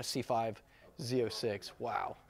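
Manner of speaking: ordinary talk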